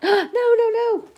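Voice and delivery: high, loud alarmed no to the pet; sudden panicked shout at the cat tearing paper; gasping, high-pitched alarm; alarmed cry. Real, not acted.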